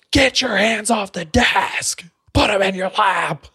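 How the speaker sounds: high, slow, stern orders; angry teacher. Retold or imitated. Teacher orders student's hands off the desk, slow stern. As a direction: spell each order out furiously.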